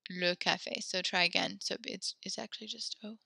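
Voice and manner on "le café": ordinary delivery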